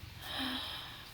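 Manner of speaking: silent breathy gasp of awe; hushed gasp at the gift; soft gasp. Real, not acted. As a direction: catch your breath quietly. Unwrapping a gift.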